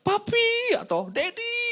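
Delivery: put-on small child calling for his dad; child's cry. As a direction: shout for daddy like a scared child. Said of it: "Tiba-tiba anak kita jerit".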